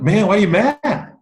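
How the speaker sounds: normal talking voice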